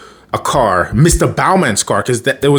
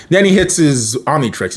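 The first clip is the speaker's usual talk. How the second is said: usual speaking voice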